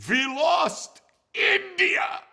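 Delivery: put-on loud, slow, high Yiddish-accented cry; thick Yiddish accent, drawn out and shouted. Polish cousin wailing at the lord in a Yiddish accent. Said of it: "in this thick Yiddish accent I can't do".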